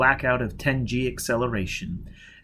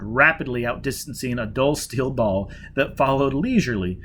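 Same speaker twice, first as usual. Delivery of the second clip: plain reading voice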